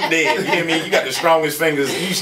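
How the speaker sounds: ordinary talk, nothing marked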